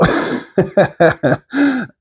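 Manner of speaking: a high, falling laugh at his own joke; amused laugh. Real, not acted. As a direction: chuckle at the absurdity.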